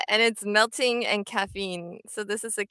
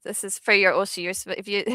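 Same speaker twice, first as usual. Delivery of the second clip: ordinary talk, nothing marked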